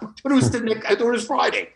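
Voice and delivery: amused, half-laughing recollection. Real, not acted. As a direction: chuckle at your own confusion. Laughing at waking up confused.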